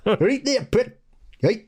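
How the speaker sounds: high, hard-to-understand Geordie imitation; mock Newcastle accent. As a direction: put on an impenetrable Newcastle accent. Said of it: "can't understand anybody".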